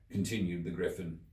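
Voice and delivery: usual speaking voice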